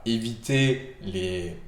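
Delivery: normal talking voice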